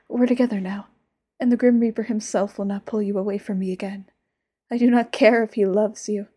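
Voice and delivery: rising jealous shout that slips out; possessive outburst. Acted. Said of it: "I didn't mean to shout".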